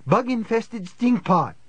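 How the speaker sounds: high, slow mocking insult; high-pitched taunting character voice; villain's taunt. A narrator's reading. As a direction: jeer it high, drawing out the words. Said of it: "sounded like a man discussing the Mets' chances".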